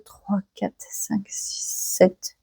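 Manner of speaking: counting under her breath, unvoiced; whispered counting. Real, not acted. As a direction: whisper the numbers to yourself while tallying.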